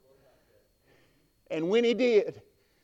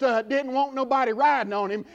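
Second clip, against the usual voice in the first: ordinary talk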